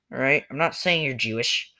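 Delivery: normal talking voice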